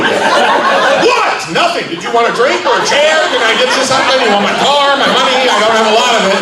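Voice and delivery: high-pitched, panicky appeasement; hammed-up cowardly backing down; instantly folding. Shown as a demonstration. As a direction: cave in at once and plead, high and eager. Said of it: "I fold immediately".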